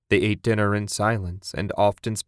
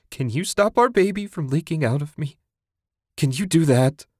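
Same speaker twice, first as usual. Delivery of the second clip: higher, bitter anguished questioning; anguished plea; 7 semitones higher than usual. A narrator's reading. Grieving wife challenging husband, higher and bitter. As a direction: throw the pain back at him.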